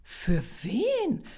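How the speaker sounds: high-pitched astonished question; puzzled surprise. A narrator's reading. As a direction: ask in sheer wonder. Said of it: "Greta hob verwundert das Kinn".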